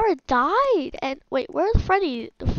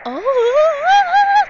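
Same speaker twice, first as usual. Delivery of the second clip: high, loud rising "oh"; sudden shriek of fright; startled shriek; 13 semitones higher, 9 dB louder than usual. Real, not acted. Player denying being freaked out, startled shriek. Startled while claiming calm.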